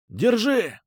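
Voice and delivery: high shouted reply. Acted. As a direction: yell it back from a distance. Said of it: "крикнули в ответ".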